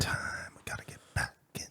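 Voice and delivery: fast, breathy whispered aside; hurried whisper. Real, not acted. Host whispering to cohost during the ad, hurried whisper. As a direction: hiss it quickly so the mic barely catches it.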